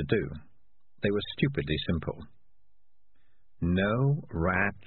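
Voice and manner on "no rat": reads No rat shall very slowly; spelling out the words haltingly; slow reading. Acted. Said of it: "he began".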